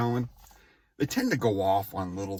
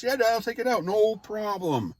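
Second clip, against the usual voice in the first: high, sing-song obedient reply; mock compliant son; 11 semitones higher than usual. Son giving the easy answer his dad wanted, high mock obedience. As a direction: sound sweetly obedient. What he should have said.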